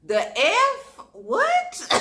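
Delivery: very high loud disbelieving cry; stunned outburst. Retold or imitated. Boyfriend admits being a meth fiend, shrieking disbelief.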